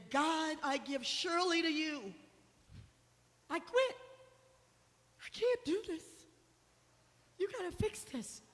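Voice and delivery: high, loud cry of surrender; desperate plea to God. Retold or imitated. Alone in the cold backyard, begging God to take over, a high loud desperate cry. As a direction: cry out to God that you give up, voice straining high.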